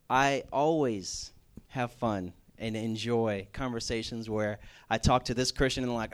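ordinary talk

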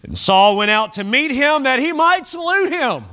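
high-pitched pulpit declamation; dramatic scripture reading. Acted. Preacher reading Saul going to greet Samuel, high declamatory reading. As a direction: proclaim it high, with a wry build.

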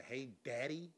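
soft, breathy-free little "Hey, Daddy" cooed low; mock sultry woman. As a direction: coo it like she wants something. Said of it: "start the conversation to".

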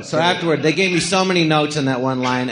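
ordinary talk, nothing marked

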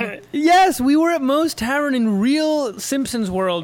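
high, loud, gushing yes; real excitement. Real, not acted. Fan recalling Moe's Tavern to friends, excited.

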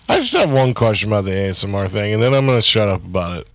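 normal talking voice

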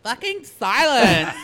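put-on high, loud, drawn-out California-accented hush; guard mimicking tourist. Guard hushing a California girl, mock exasperated call. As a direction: stretch it out like an exasperated Valley girl. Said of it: "And he's like".

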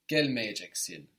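gelmeyeceksin with a punched "gel", falling after it; stress on GEL. Shown as a demonstration. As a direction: hit the first syllable of gelmeyeceksin and let the rest drop. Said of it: "the accent's there on the gel".

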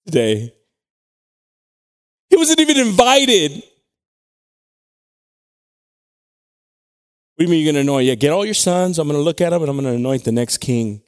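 loud, high, driving sermon voice; preaching at full force. Acted. Pastor preaching about David to the church, loud. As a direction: preach it hard to the back row.

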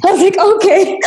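high laughing retelling; giggling through the words. Real, not acted.

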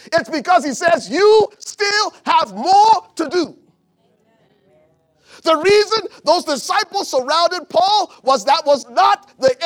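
loud, high, slow shouted sermon; roaring preacher climax; full-force preaching. Acted.

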